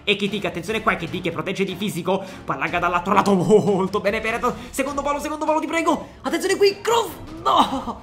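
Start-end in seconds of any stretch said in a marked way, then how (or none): 4.2-8.0 breathless italian goal commentary. Acted. Play-by-play of the cross.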